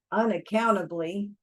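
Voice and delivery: usual speaking voice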